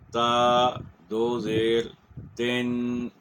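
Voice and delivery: تا دو زیر drawn out slowly, then تن with a clear nasal n; spelling out tanween. Shown as a demonstration. A teacher drills students on tanween, spelling it slowly. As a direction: spell it out slowly, one part at a time. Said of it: "تو ہم کہیں گے".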